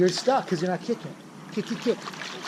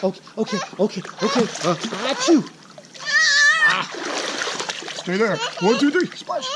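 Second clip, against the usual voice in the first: put-on much higher sing-song cheering for a baby; baby-talk cheering; 11 semitones higher than usual. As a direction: cheer the little swimmer on, bright and bouncy. Dad cheering baby in pool.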